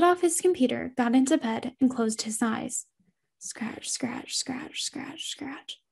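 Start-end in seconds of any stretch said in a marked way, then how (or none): 3.4-5.8 breathy whispered scratching, barely audible. Acted. Eerie unseen thing scratching, whispered. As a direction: hiss the scratches softly in the dark.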